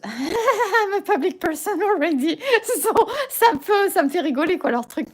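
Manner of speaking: laughing while talking, voice high. Real, not acted. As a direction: chuckle through the words. Laughing at the app rules.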